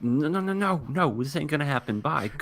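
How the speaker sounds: high-pitched flustered refusal, imitated; mock flustered. Girl fleeing the bunny costume, mock flustered. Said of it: "She just gets all flustered".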